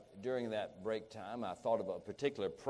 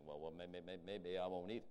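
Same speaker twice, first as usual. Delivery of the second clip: hesitant, softer backpedaling, tripping over repeated words; backing down; 3 semitones lower, 7 dB quieter than usual. Retold or imitated. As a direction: imitate someone sheepishly backing out, stammering a little. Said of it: "that man backed up".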